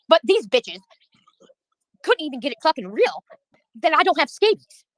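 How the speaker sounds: usual speaking voice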